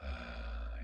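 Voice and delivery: ordinary talk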